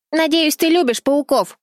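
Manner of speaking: high, gleeful triumphant shout; gleeful yell. A narrator's reading. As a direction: crow it out in victory. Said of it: "ликующе завопила Чарли".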